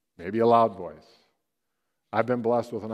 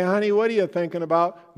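asking wife; 7 semitones higher, 6 dB louder than usual. Retold or imitated. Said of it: "you say".

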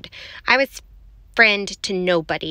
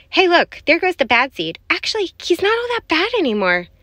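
higher-pitched voice of a surprised bystander; onlooker calling out; 8 semitones higher than usual. A narrator's reading. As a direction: point someone out in a high, lively voice. Other seeds voiced, calling out.

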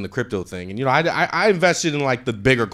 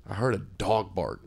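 usual speaking voice

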